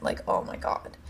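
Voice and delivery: whispered disbelief; stunned whisper. Real, not acted. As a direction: breathe it out under your breath. Shocked aside after the carbs comment.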